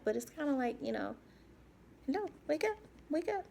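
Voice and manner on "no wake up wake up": mock nurse calling her awake, pitched higher; nurse waking patient. Nurses rousing her after surgery, higher calling. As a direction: imitate a brisk nurse calling wake up. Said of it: "I recall, like, trying to, ... they're waking me up".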